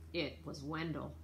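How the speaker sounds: ordinary delivery, nothing marked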